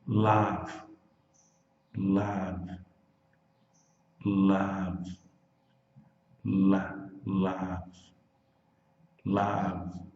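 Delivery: Love ending on a voiced V, no vowel after; Love with a clean final V. Shown as a demonstration. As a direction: close Love on the V sound. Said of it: "O V em inglês é V".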